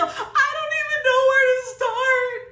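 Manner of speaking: high, loud, drawn-out wail; despairing wail. Real, not acted. Client stuck with glue in her ponytail, despairing wail. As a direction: wail it slowly in helpless frustration.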